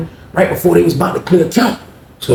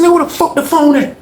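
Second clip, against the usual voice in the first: mock angry demand; 11 semitones higher, 3 dB louder than usual.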